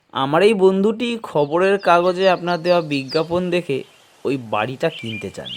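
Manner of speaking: plain reading voice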